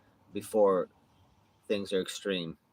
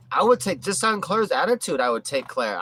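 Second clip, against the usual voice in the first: ordinary talk, nothing marked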